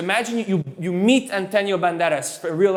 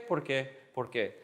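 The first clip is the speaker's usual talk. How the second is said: low, soft falling parental answer; mom answering because; 5 semitones lower, 10 dB quieter than usual. Acted. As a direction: drop it low, answering the child. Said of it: "a, a mom".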